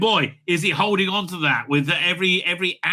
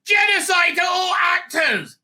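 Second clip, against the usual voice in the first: slow, high furious yell; angry shout; 12 semitones higher, 4 dB louder than usual. Real, not acted. Yelling at the awards telecast, furious. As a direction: roar it at the screen.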